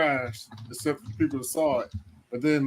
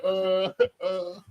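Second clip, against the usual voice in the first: higher, drawn-out "uh"; imitated stunned "uh"; 5 semitones higher, 4 dB quieter than usual. Imitating everybody in the restaurant, stunned "uh". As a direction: mimic a frozen crowd with a long "uh".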